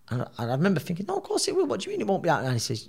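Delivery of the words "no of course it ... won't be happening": much higher pitched, stunned protest; shocked disbelief. Retold or imitated. Boxer told fight is off, high disbelieving protest. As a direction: refuse to believe what you just heard. Doctor says he can't fight.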